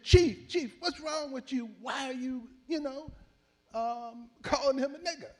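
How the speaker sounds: high, loud holler breaking into the fight; a loud high retold shout. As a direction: yell it to stop the fight. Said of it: "jumping in and hollering at".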